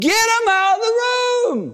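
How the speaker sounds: very high, straining shout; shouted order. Acted. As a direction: cut in with an exasperated yell. Grandpa cuts in shouting order.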